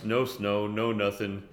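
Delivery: ordinary talk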